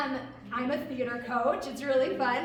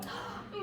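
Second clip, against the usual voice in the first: excited gasp; 8 dB quieter than usual. Shown as a demonstration. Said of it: "say it as happy as we can".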